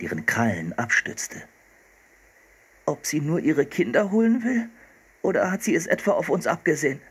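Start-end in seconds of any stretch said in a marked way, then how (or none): 2.8-7.0 anxious. A narrator's reading. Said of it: "fragte Philipp ängstlich".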